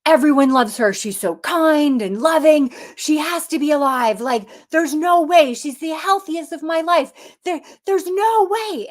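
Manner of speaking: high, loud desperate plea; distraught pleading. Retold or imitated. The crying man at her bedside, distraught pleading. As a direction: beg that she survive, near tears.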